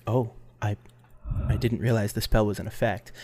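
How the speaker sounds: mild, sheepish aside as the character; lightly voiced festival founder. Festival founder realising the crowd hears him, mildly flustered.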